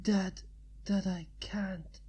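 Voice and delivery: trails off into a whisper; dying man's last words, whispered away; fading whisper. Acted. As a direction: let the words die into breath. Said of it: "His voice trailed off".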